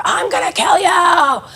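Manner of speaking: high, slow mock shout of a furious kid; a kid screaming a threat in full fight mode; imitated loud threat. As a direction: yell it out like a kid in a meltdown. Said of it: "like, a loud".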